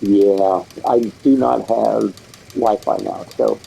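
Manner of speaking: usual speaking voice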